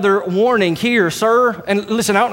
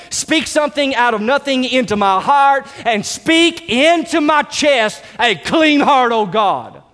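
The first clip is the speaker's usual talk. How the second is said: fervent prayer; 6 semitones higher than usual. Retold or imitated. Preacher voicing David's repentant prayer, higher and impassioned. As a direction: pour it out like David on his knees. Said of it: "that's what comes out of his mouth".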